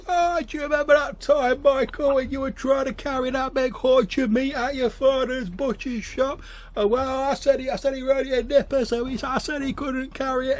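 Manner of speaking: put-on drunk storyteller. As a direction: bellow an embarrassing story at him. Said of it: "He's like, he's like".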